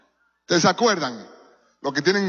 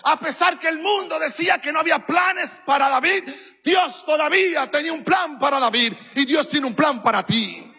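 preaching at full force; 6 semitones higher than usual. Acted. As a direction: drive it home to the congregation.

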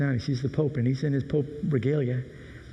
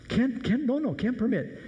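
high, frantic pleading of a nervous Italian driver; much higher, begging the Pope not to; upset Italian chauffeur; 10 semitones higher than usual. Acted. Chauffeur begs the Pope to keep the windows up, high and frantic. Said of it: "the Italian chauffeur gets very upset".

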